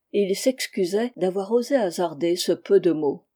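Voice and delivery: usual speaking voice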